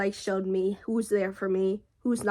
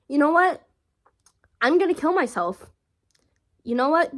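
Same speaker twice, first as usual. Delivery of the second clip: higher, louder retold outburst in separate bursts; mock accusation; 5 semitones higher, 4 dB louder than usual. Vlogger retelling boyfriend's threatening message, acted accusingly. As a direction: imitate someone throwing an accusation, with pauses.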